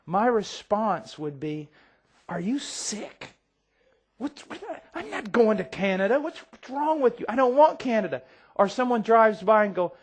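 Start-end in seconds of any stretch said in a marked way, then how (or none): 2.3-8.2 high, loud indignant retort; how dare you, shouted back; incredulous outburst. Retold or imitated. Father snapping at a friend, loud and incredulous.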